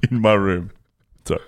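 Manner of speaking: put-on a light Afrikaans accent, mostly the speaker's own voice; South African accent. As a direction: reads the listener's story in a hinted South African accent. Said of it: "in a South African accent".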